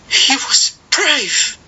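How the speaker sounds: high, slow grieving cry, not loud; boy crying out softly. A narrator's reading. Boy alone in the dark, high slow grieving voice. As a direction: say it aloud under breath with grief. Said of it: "cried suddenly, aloud but not loud".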